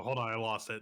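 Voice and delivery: ordinary talk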